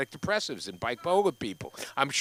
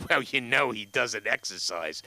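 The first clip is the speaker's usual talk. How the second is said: lower, slow sly tattling; lightly voiced snitching daughter; 6 semitones lower than usual. Daughter to the doctor, slow sly snitching. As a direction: tattle slowly and slyly.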